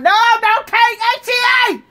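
high, loud hammed-up wail; theatrical bawling protest; mock crying. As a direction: plead at speed in an exaggerated cry. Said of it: "Now y'all crying".

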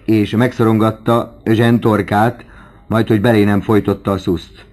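ordinary delivery, nothing marked

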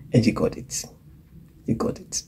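hushed unvoiced affirmation; soft whisper. Real, not acted. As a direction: whisper it tenderly to the listener. Preacher affirming softly.

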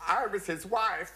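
high, slow mock widow; lightly voiced weeping widow; tearful widow. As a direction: say it like a grieving widow.

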